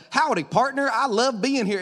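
put-on Southern cowboy accent, lightly done; mock cowboy voice. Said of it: "That's not how you sound normally".

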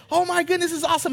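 mock kid glee; kid's delight. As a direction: gush like a thrilled boy. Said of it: "he's like".

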